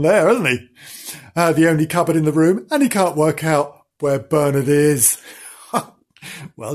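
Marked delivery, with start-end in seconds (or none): none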